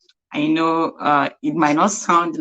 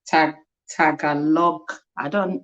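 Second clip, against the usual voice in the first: ordinary delivery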